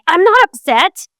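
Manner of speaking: high, rising, offhand "not"; dismissive denial. Retold or imitated. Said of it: "And they reply".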